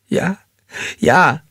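high, anxious yes; frightened assurance. A narrator's reading. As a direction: insist fearfully, voice up high. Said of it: "beteuerte sie".

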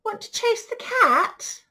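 high, loud storybook dog; dog's voice. Acted. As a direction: call out the invitation as a dog. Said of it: "barked the dog".

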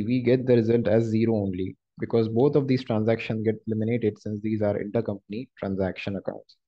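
ordinary talk, nothing marked

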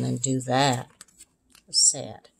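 a breathy whisper of sadness; whispered sad. A narrator's reading. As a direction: drop to a whisper. Narrator sums up mood.

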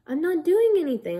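high, loud protesting denial; acted denial. Nurse denying it to the patient, high mock protest.

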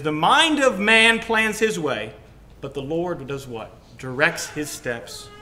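loud, ringing preaching of the verse; scripture proclaimed. Acted. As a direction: proclaim the promise at full force.

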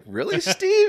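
very high, loud "Really, Steve?"; giddy surprise. Retold or imitated. Fan overhearing his hero's praise, giddy and high. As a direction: squeal it out, pitched far up. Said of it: "I'm like".

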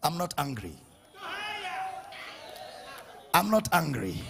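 low, soft, unhurried voice of God; gentle reassurance. Acted. God looks at the believer, gentle reassurance.

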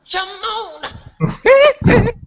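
a shrill falsetto squeal of a laugh; high squealing laugh. Acted. Host squeals at a guest's entrance, high falsetto. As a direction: burst into a high squeal, far above your voice. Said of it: "I promised I wouldn't do that".

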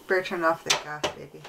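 usual speaking voice

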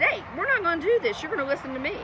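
scolding. Acted.

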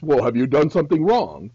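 loud sneering cop asking; sarcastic officer. Retold or imitated. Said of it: "They'll say something stupid as".

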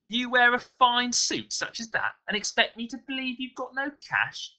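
high-pitched, incredulous mugger; scoffing mugger. A narrator's reading. Mugger doubting the well-dressed victim, scoffing.

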